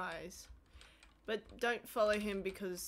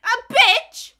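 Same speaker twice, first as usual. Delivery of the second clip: yelling it out in jest; high, loud hammed-up shout; loud mock outburst; 12 semitones higher, 20 dB louder than usual. Mock outburst in playful quote.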